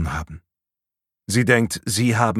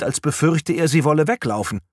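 ordinary delivery, nothing marked

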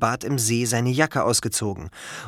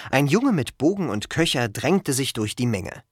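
ordinary delivery

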